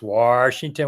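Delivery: "Washington" drawn out as "Warshington", with a clear R. Shown as a demonstration. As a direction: slowly show the R in "Washington": "Warshington". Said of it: "with an R".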